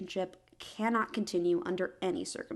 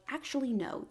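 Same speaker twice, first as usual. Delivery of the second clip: normal talking voice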